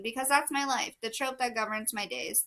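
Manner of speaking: usual speaking voice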